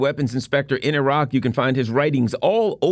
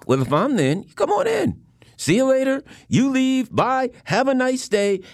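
much higher, sing-song mock politeness; sarcastic sweet host voice, pitched up; mock voice of China; 10 semitones higher than usual. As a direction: wave her in and out with fake courtesy. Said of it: "I thought".

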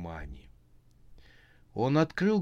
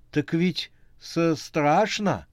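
scared; 7 semitones higher, 5 dB louder, slower than usual. A narrator's reading. Max still scared after scream.

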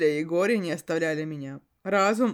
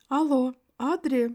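friend's voice; 7 semitones higher than usual. Acted. Friend calling on phone.

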